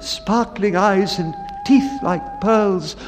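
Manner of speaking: bright, rising and lively, carried by the music; excited. A narrator's reading. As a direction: read it like children tumbling after a tune. Children skipping after piper's music.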